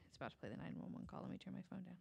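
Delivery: usual speaking voice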